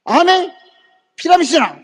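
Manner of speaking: high-pitched mock accusation; scornful accusation. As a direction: throw out the accusation, imitating a hostile man. Man accuses business of pyramid scheme.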